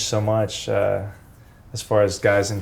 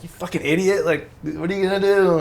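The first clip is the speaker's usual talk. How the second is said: much higher, teasing put-down of a friend; mocking scold; 7 semitones higher than usual. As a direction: rib him, pitched way up. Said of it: "And we're like".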